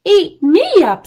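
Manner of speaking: put-on high, loud indignant old lady. A narrator's reading. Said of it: "said the old lady".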